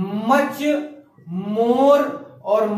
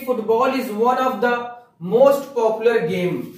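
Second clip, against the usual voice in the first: slow dictation; much slower than usual. Shown as a demonstration. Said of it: "teacher dictating the sentence".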